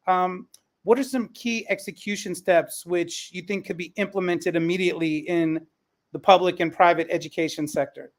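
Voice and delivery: normal talking voice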